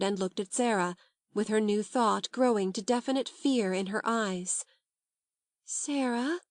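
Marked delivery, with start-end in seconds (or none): 5.7-6.4 high, hushed and timid; timid awe-struck. A narrator's reading. Ermengarde to Sarah, timid and awed. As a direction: ask it shyly, half afraid. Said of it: "in a timid, almost awe-stricken voice".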